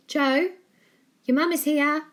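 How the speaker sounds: loud call from afar. A narrator's reading. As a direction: call him from the hall. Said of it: "called A J's mum from the hallway".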